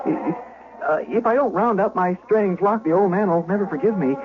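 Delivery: high, loud, stammering panic; terrified stammer. Acted. Shepherd boy stammering denials to the questioning lord. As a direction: blurt the denials out, tripping on every word.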